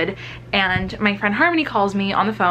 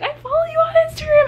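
much higher and fast, like a starstruck fan; excited fan squeal; starstruck gush; 4 dB louder, faster than usual. Retold or imitated. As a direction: gush it out high and quick. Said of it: "I'm like".

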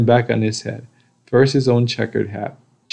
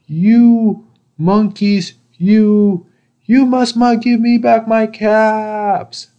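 high, loud, slow storybook shout; angry shout; 11 semitones higher, 5 dB louder, slower than usual. A narrator's reading. Very angry peddler yelling at the monkeys, high drawn-out shout. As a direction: stretch every word into a high furious yell. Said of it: "and he shouted".

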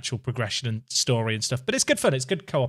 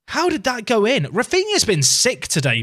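high, loud amazed outburst; real excited disbelief; 9 semitones higher, 6 dB louder than usual. Real, not acted. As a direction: shout it in amazement at the goal. Repeats shock, excited about goal.

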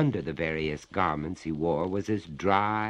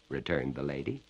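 ordinary delivery

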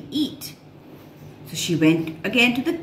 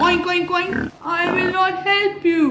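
high oink oink then refusal; pig oinking; 10 semitones higher than usual. A narrator's reading. Pig refusing the hen, oinking. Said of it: "The pig said".

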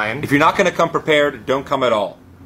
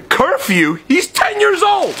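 high outraged disbelief; outraged shout; 12 semitones higher, 4 dB louder, slower than usual. Acted. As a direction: cry out in disbelief. Mom outraged at curfew.

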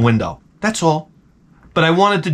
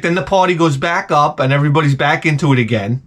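usual speaking voice

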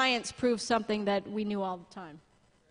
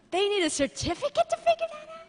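much higher sarcastic squeak; mock disbelief; 4 dB louder than usual. Real, not acted. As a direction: scoff in high incredulity.

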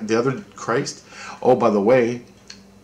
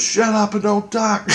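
very high, slow scold; squeaky scolding; 11 semitones higher than usual. Retold or imitated. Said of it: "And he goes".

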